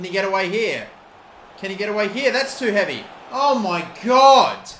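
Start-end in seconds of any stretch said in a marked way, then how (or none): 3.3-4.7 slow, loud, drawn-out groan of despair; real exasperated outcry; exasperated cry. Real, not acted. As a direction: stretch it out in disbelief. Player's touch too heavy.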